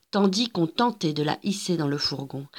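ordinary delivery

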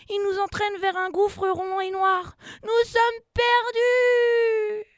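high shout building to a long wail; panicked cry. A narrator's reading. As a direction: wail that all is lost. Crie Enza, submarine swept away. (cri de panique qui monte)

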